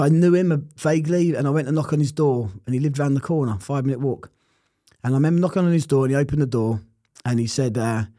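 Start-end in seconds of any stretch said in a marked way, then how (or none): none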